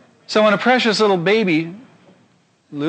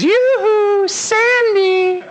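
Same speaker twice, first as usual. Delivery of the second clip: high falsetto, drawn-out teasing call; hollering Satan; much slower than usual. Acted. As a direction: sing it out sweetly across a gulf. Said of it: "he hollers across the gulf".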